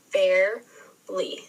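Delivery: "Barely" slowly broken into bare, ly; split into syllables. Shown as a demonstration. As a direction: sound out "Barely" with a long gap in the middle. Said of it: "break down those syllables".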